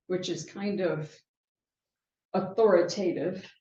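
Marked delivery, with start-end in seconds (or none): none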